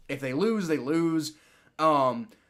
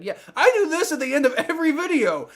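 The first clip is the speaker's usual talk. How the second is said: worked-up protest; 9 semitones higher, 4 dB louder than usual. Real, not acted. Ranting about taunting call.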